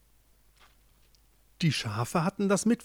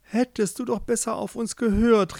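higher, mildly desperate cry; desperate call; 7 semitones higher than usual. A narrator's reading. Joringel calls to his frozen beloved, mildly desperate. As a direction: call it out with a touch of despair. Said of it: "rief Joringel verzweifelt".

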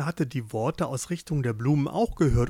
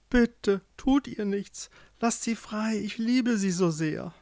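higher, earnest begging; pleading; 6 semitones higher than usual. A narrator's reading. Joringel begs the witch to free his beloved, pleading. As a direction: plead for her life. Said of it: "Joringel flehte".

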